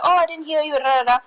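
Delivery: breezy, high-pitched mock apology; imitated friendly apology. Acts out friend greeting back.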